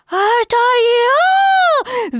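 very high, loud, drawn-out squeal; beside himself with excitement; little Roo squealing; squealing excitedly. A narrator's reading. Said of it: "запищал Крошка Ру вне себя от волнения".

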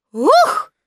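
high, loud "ookh" on impact; landing "ookh". A narrator's reading. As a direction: let out a jolted yelp as you land. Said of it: "а спускаясь вниз, он говорил". (громкое «ух» при приземлении)

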